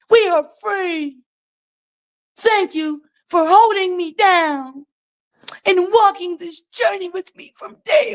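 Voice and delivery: high, slow, mock-crying reading; mock tearful. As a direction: ham up a weepy farewell letter. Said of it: "Let's read that again".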